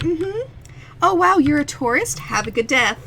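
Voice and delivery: overly sweet tourist greeting; customer service voice. Retold or imitated. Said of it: "Just like".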